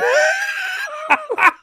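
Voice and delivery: shrieking burst of laughter. Real, not acted. Laughing at own story.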